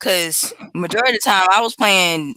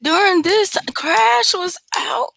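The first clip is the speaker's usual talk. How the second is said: high, slow incredulous question; surprised; 12 semitones higher, slower than usual. Real, not acted. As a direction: stretch it out in disbelief. Surprised recall.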